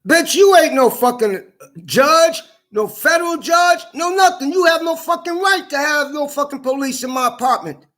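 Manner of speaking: high, strained, outraged yell; furious shouting. Real, not acted. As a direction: tear into her at the top of your range. Furious outburst at woman who had residence searched.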